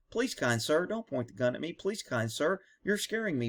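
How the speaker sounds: mock meek officer; mock timid plea. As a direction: beg quietly and politely, mocking. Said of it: "go through the steps of".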